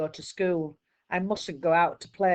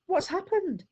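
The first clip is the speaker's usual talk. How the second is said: put-on high, weak, squeaky child's voice; wounded boy waking up, tired and squeaky; 13 semitones higher than usual. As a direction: wake up groggy and squeak it. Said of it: "My voice is all tired and squeaky".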